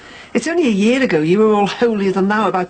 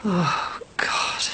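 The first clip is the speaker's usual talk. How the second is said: breathy whispered oh god; whispered dismay; 3 semitones lower, 6 dB quieter than usual. Acted. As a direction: whisper it in despair. Mother's dismayed reaction.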